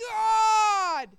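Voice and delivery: long, high, full-force shout to God; desperate drawn-out yell; yelled cry. Retold or imitated. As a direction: scream it out at the top of your voice, drawn out. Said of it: "and I just yelled".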